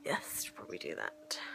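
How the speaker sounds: hushed whisper so the film isn't drowned out; whisper, the film is playing. Real, not acted. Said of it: "so I probably shouldn't talk".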